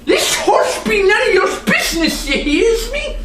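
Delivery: growled threat. Acted. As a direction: growl a warning at the child. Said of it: "growled Mister Nye".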